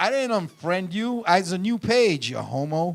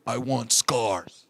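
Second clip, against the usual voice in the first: low, drawn-out delivery; grave demand; 8 semitones lower, 3 dB quieter, much slower than usual. Retold or imitated. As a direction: imitate a wrestler solemnly stating his demand. Quoting Vince's grave request.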